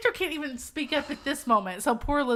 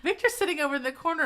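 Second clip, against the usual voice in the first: usual speaking voice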